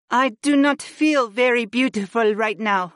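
high, downcast female voice; sighing wife. A narrator's reading. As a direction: lament it in the wife's high voice. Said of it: "Freya sighed".

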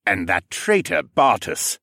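a slow, menacing growl; a regent growling at a traitor. Acted. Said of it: "Regent Siskern growled".

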